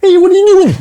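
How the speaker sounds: high squeaky frog voice, loud; grumpy old man coming out as Kermit. Retold or imitated. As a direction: try to sound gruff but squeak like Kermit. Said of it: "we got told off by Kermit".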